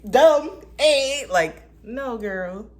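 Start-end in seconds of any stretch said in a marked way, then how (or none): 0.1-1.3 very high, loud goofy voice; hammed-up clueless squeal; mock dumb self. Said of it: "before I was just like".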